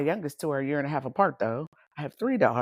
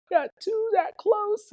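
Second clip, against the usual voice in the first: mock astonishment. Mock exclaiming at the situation.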